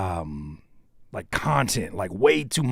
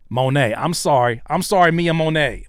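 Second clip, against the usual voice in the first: loud, higher-pitched mock pleading; exaggerated apology; 5 semitones higher, 7 dB louder than usual. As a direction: apologise loudly over a botched name. Exaggerated apology for mangled name.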